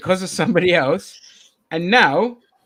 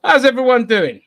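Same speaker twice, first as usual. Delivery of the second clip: loud host greeting; 7 semitones higher, 11 dB louder than usual. Acted. Hyped host greeting the audience.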